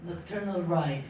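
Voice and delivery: normal talking voice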